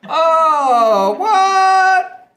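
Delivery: high, loud, drawn-out whoop; thrilled outcry. Real, not acted. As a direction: cry out in delight at the reveal. Delighted at own cow reveal.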